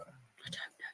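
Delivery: breathy whispered aside. Real, not acted. Vlogger watching the hedgehog, hushed whisper. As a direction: whisper so as not to scare it.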